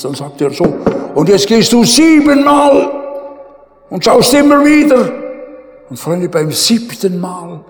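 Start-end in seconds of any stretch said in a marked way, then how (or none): 1.1-5.1 much higher and louder urging; urgent command. Retold or imitated. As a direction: send him again with urgency. Prophet urges servant to go again.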